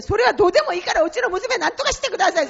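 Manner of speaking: 高く大きく速く、必死に叫ぶ; desperate crying plea. Retold or imitated. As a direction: blurt it out fast, begging for your daughter. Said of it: "泣きながら". (娘のために泣きながら必死に叫ぶ)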